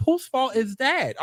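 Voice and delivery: ordinary talk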